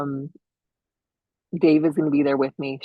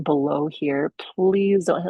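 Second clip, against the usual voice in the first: ordinary talk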